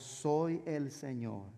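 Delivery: slow, low, soft divine voice; voice of the Lord. Acted. The Lord answering the frightened cleaner, slow and low. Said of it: "Y respondió la voz".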